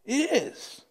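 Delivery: short, insistent yes rising high; emphatic. Acted. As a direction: press the answer stubbornly. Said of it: "Jonah's more emphatic".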